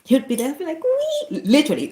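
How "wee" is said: high, drawn-out squeal of delight; imitated gleeful "wee", very high; little boy's glee. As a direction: squeal it like a kid splashing in a tub. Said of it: "see this little boy come out".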